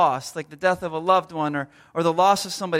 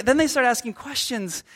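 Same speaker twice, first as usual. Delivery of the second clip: ordinary talk, nothing marked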